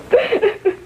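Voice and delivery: a short high laugh cracking with grief; girl laughing through grief over her dead boyfriend; bitter broken laugh. Acted. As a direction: laugh that is almost a sob. Grieving girl breaks after "married.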